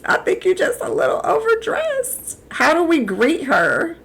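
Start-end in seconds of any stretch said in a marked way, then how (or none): 0.0-2.4 high teasing. Pretend. Teasing in falsetto.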